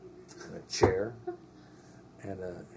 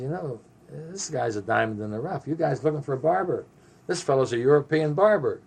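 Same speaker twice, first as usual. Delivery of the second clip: louder, higher retold sales pitch; emphatic pitch; 6 semitones higher, 9 dB louder than usual. Selling Stern to the battalion as barber, emphatic and loud. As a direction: talk him up, building to a loud finish.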